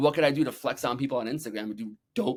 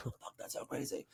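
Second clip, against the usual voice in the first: very quiet, breathy aside in retelling; retold awe under the breath; stunned whisper; 17 dB quieter than usual. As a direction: whisper it as your amazed reaction. Retelling his stunned reaction.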